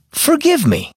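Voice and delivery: put-on high child's voice announcing the title; child saying the title. A child echoing the story title for listeners, in a high child voice. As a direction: let a little kid chime in with the name. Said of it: "Our story for today is called".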